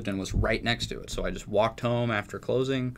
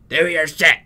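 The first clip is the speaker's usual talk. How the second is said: imitating the boss, a loud high bark; mock militant shout; 6 semitones higher, 11 dB louder than usual. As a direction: yell it like a drill sergeant boss. Manager barking orders, militant.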